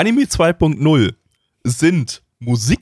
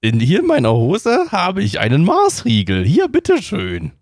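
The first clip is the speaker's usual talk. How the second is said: creepy candy man; 6 semitones higher than usual. Acted. Candy man hands out sweets.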